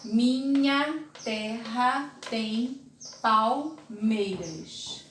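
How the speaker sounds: very slow, each syllable separated; scansion reading. Shown as a demonstration. Teacher scanning the verse for students, very slow and syllable by syllable. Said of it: "E eu vou".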